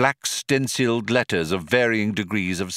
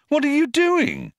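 very high-pitched astonished question; exclaiming; 3 dB louder than usual. A narrator's reading. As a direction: ask it in high-pitched amazement. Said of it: "he exclaimed".

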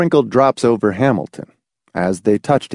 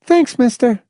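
put-on high girl voice; 13 semitones higher than usual. Girl's voice.

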